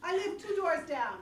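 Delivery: put-on Russian accent. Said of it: "It was Russian".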